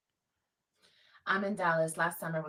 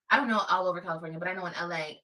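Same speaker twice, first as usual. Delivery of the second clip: ordinary talk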